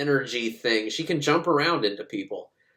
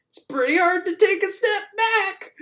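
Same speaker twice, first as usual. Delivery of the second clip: mock solemn. Colonel's solemn line.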